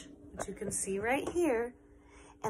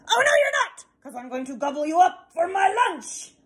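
loud, much higher shouting troll; troll threat; 8 semitones higher, 7 dB louder than usual. Acted. Troll threatens big goat.

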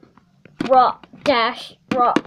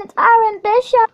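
higher, louder fangirl announcing "Arwen Bishop"; excited announcement; 8 semitones higher, 4 dB louder, faster than usual. Acted. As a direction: call out her name to the crowd.

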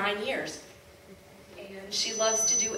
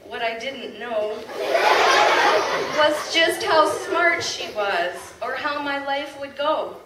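proud, louder poem reading with laughs; poem recital; 2 semitones higher, 6 dB louder than usual. Acted. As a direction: recite it to the crowd with pride. Reads a poem aloud.

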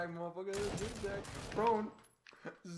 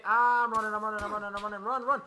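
panicked running; 7 dB louder, faster than usual. Real, not acted. Fleeing gunfire in panic.

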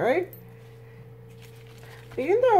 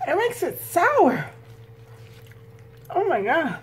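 disgusted; 8 semitones higher than usual. Real, not acted. Complains food too sour.

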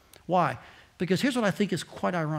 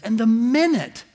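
ordinary delivery

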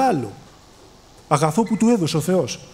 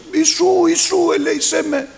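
imitated calling out; 11 semitones higher than usual. Blind Bartimaeus calling to Jesus as he passes, imitated calling out. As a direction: call out high, as the blind man begging for mercy. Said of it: "άρχισε και φώναζε".

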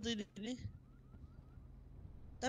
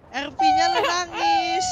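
mock crying; 10 dB louder, much slower than usual.

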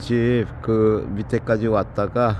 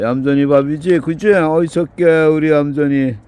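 raised cooing voice to a hungry cat; 4 semitones higher, 5 dB louder than usual. Real, not acted. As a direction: coax the cat up high while serving food. Man cooing at cat while feeding.

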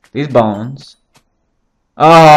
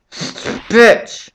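angry outburst; 8 semitones higher than usual. Real, not acted. Hit again, lashing out.

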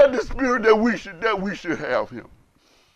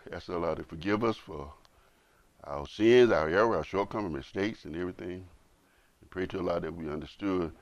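soft, lower closing prayer; quiet prayer; 13 semitones lower, 9 dB quieter than usual. Acted. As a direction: pray gently over the congregation. Leads closing dua softly.

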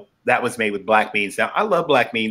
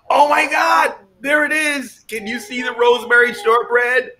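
excited; 10 semitones higher than usual. Real, not acted. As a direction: squeal over the shortbread. Shortbread revealed.

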